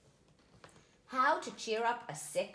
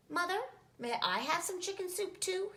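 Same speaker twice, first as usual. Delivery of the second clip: put-on small child voice; 9 semitones higher than usual. Little spider asks.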